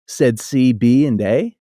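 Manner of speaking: ordinary delivery, nothing marked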